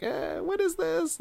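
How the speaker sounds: very high, slow and quiet puzzlement; baffled mock voice. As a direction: squeak out confused disbelief. Mock baffled viewer reaction.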